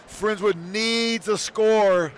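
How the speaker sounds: needs drawn out and leaned on, whole line slow; stress on needs. Shown as a demonstration. Announcer stressing what the team must do, weight on needs. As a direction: press hard on the word needs. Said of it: "I can't emphasize that word needs".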